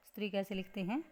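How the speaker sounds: normal talking voice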